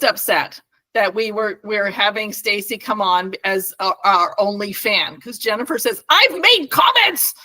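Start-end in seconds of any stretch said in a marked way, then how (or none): 6.1-7.3 high, slow mock yell; mock outrage, pitched well up; retold yelling. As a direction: imitate an indignant fan yelling. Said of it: "She's yelling at me".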